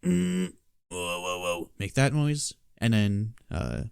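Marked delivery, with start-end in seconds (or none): 0.1-1.7 throat bass. Shown as a demonstration. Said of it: "Throat bass".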